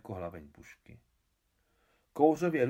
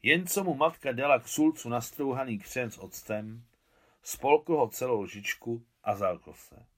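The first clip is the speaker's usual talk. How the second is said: usual speaking voice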